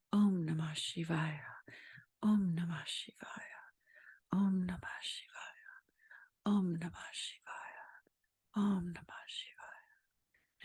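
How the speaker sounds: quiet inward mantra. Shown as a demonstration. Meditation teacher bringing the mantra quietly inside, hushed fading whisper. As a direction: let the repeated chant sink into a whisper. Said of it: "bring it quietly inside of you".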